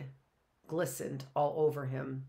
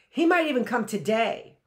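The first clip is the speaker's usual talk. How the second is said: much louder, higher, insistent; too loudly; 6 semitones higher, 9 dB louder than usual. A narrator's reading. Matt answers Sackness angrily, far too loudly. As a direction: overcompensate with volume. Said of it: "too loudly".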